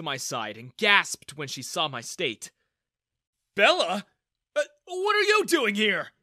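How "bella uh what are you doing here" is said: loud, high, startled question; stammer, then blurt it out loudly; surprised. A narrator's reading. Father startled to see his daughter there, surprised. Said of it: "Daniel asked in surprise".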